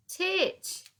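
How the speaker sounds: high surprised cry; exclamation. A narrator's reading. Dotty spotting Titch at the window, exclaiming. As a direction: cry out his name in delight. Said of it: "exclaimed Dotty".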